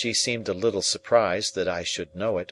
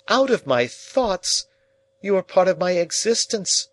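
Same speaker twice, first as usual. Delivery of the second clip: higher, louder, impassioned outpouring; passionate declaration; 8 semitones higher, 4 dB louder than usual. A narrator's reading. Pip declaring to Estella she is part of him, impassioned. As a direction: pour out your heart, rising.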